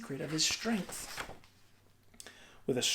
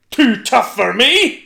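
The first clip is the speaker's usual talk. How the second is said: slow, much louder offended shout; indignant shout; 5 semitones higher, 11 dB louder, slower than usual. A narrator's reading. Giant insulted by Una, shouting. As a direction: bellow back, insulted. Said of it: "shouted Cucullin".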